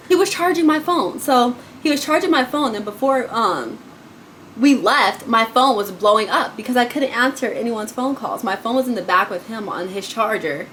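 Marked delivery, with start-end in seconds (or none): none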